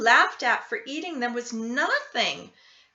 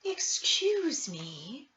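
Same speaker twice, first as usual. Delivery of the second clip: a lightly voiced sweet old woman, slow; polite old lady, a touch bright; gentle slow granny voice; 2 semitones higher, much slower than usual. A narrator's reading. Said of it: "she said brightly".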